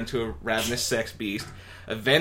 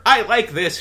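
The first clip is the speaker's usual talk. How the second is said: pleased; 10 semitones higher, 9 dB louder than usual. Acted. Tasting the dip.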